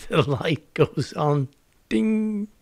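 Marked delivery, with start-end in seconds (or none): 1.9-2.5 a high, bright little ding for the lightbulb moment; mock bell ding. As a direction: chime it like a lightbulb switching on. Light goes on, sound effect.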